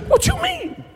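very high, squealed mock disbelief; mock incredulity. Onlookers answering "that's just not like me," high-pitched mock incredulity. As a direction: squeal it softly like a baffled onlooker.

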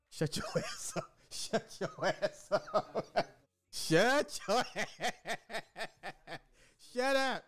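high, breathless laughing through the words; can barely get it out for laughing; real giggling fit, voice up high. Real, not acted. Cracking up at his own rhyme.

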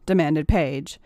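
ordinary delivery

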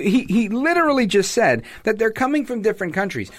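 ordinary delivery

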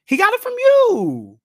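high, loud and slow accusation; he got it from you, with a loud high stressed you; accusing shout. Acted. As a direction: throw the blame back at the parent. Mock accusation to parents.

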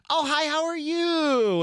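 very high, slow, sing-song phone greeting; mom's phone voice. Retold or imitated. Mom picking up the phone to a friend, very high and sing-song. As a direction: sweeten it like mom answering a call. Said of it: "my mom would have that phone voice".

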